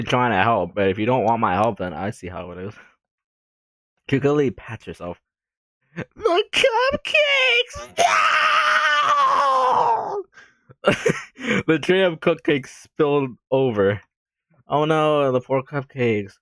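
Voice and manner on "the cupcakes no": very high drawn-out wail of dismay; dismayed cry. Acted. Natsuki seeing her cupcakes spill, wailing. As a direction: wail like a child losing treats.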